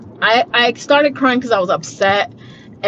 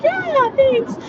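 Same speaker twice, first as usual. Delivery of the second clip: mock polite thanks. Patient thanks tech after recognition.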